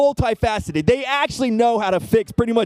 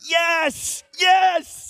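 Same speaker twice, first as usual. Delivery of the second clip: triumphant cheer; 11 semitones higher than usual. Retold or imitated. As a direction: celebrate like you just won. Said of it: "I was like".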